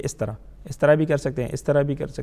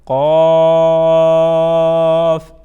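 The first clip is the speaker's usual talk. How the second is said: قاف الف stretched long and loud; drawn out spelling; 8 dB louder, much slower than usual. Shown as a demonstration. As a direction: chant it slowly and loudly for the children. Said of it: "drawn out spelling". (قاف الف کو کھینچ کر بلند آواز میں)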